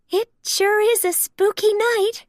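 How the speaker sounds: high Daisy voice, nervous; a hint of nervous giggle. Daisy uneasy about the stormy night. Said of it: "Daisy giggled nervously".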